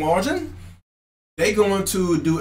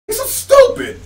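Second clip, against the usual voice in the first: much higher, louder mocking stupid; shrill loud jab; 5 dB louder than usual. Shown as a demonstration. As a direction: fling it out high and loud. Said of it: "mocking repeat".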